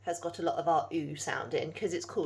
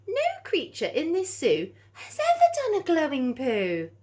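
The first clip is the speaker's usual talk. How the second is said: amazed zookeeper; 11 semitones higher, 9 dB louder than usual. Acted. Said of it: "he whispered to himself".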